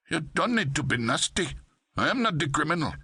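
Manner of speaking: put-on a wounded Pakistani accent. A narrator's reading. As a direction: clerk protesting to the cop in a Pakistani accent. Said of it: "sing-song Pakistani accent".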